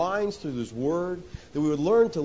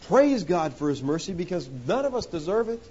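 ordinary delivery

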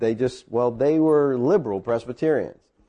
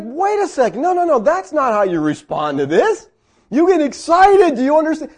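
high, loud, insistent objection; indignant protest; 12 semitones higher, 5 dB louder than usual. Retold or imitated. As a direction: plead loudly as if they missed the point. Acted protest to unresponsive friends.

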